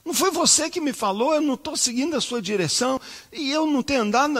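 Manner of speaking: high, pleading cry to God. Retold or imitated. Pastor on his knees crying out to God in prayer. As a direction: call out to God, pitched high. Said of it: "e comecei a clamar".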